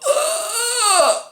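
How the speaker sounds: long high-pitched mock wail; mock horrified cry. Husband finding his wife's dead body, mock horrified cry. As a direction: imitate a shocked cry, high and drawn out.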